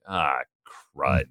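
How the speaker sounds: usual speaking voice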